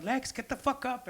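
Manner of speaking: high, slow mock yell; a friend yelling at him to get up; retold yell. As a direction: call him up off the ground. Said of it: "I hear somebody yelling".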